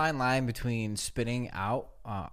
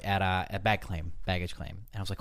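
usual speaking voice

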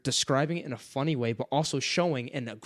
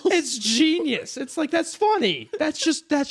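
high, gushing praise; overjoyed, almost squealing; delighted; 12 semitones higher, 4 dB louder, slower than usual. Real, not acted. Praising Pratchett's line to a co-host, high and delighted.

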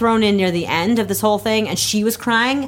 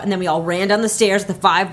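ordinary talk, nothing marked

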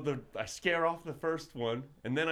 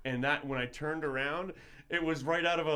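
ordinary talk, nothing marked

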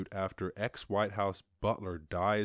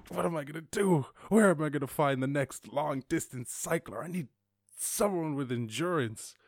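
mock exasperated coach, higher pitch; a hint of desperate coach, voice raised in pitch; despairing coach; 5 semitones higher than usual. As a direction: lightly act a coach at wits' end, pitched up. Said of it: "everyone let him down".

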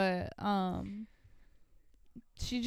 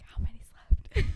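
mock whisper, copying the others; imitated whisper; 4 dB louder, faster than usual. Teasing the guys for their overheard question, imitated whisper. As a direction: whisper it as if quoting someone's secret aside. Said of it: "I could hear you guys whispering".

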